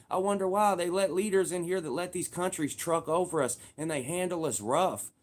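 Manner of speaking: normal talking voice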